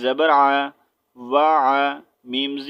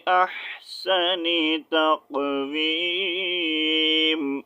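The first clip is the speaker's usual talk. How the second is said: Quran recitation; 3 semitones higher, much slower than usual. Shown as a demonstration. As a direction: chant the whole surah slowly, each verse drawn out. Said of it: "پڑھ کر سنا دیتا ہوں".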